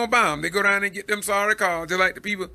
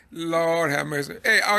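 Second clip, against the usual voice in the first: ordinary talk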